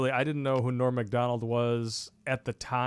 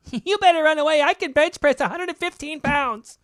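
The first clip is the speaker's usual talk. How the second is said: much higher, louder mocking falsetto boast; mock tough female soldier; 7 dB louder than usual. Host mocks a female Ranger by boasting in a high falsetto. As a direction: lampoon a weak soldier acting tough.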